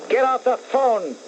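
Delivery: slow, high, deliberate put-down; rehearsed put-down. Pretend. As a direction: lean on each word, slowly. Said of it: "Are you ready?".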